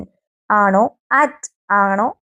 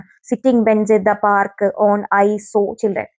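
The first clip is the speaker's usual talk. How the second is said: slow wrong example; 2 semitones lower, much slower than usual. Shown as a demonstration. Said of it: "ആ ഒരു arrangement തെറ്റാണ് അല്ലേ".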